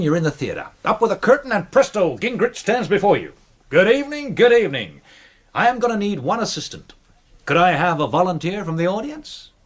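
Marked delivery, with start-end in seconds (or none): 3.7-9.5 a hint of a showman's patter, raised; lightly hammed-up showman greeting the crowd; stage magician patter. Magician greeting the theatre audience, showman patter. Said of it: "The incredible Gingrich".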